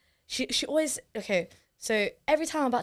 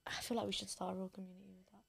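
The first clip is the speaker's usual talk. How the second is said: soft, unvoiced whisper, fast; whispered aside; 2 semitones lower, 16 dB quieter, faster than usual. Real, not acted. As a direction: drop to a quick whisper as an aside. Whispered aside about starting community.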